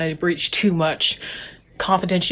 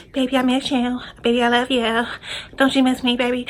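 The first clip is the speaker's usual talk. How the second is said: higher mock sweet talk to boyfriend; mocking lovey-dovey; 5 semitones higher than usual. Roommate cooing to her boyfriend on the phone, mocking. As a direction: parody a clingy phone call.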